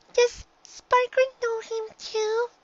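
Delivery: high little filly voice; a small girl pressing her mother; high-pitched child pony insisting; young filly. A narrator's reading.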